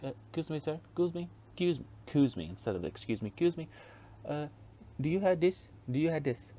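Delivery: lightly hammed-up Mexican accent asking for help; a customer's Mexican accent, mildly mocked; imitating Mexican accent. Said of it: "his accent and how he asked me this".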